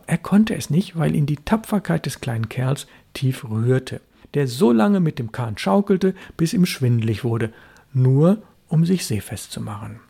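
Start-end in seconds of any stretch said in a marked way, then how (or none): none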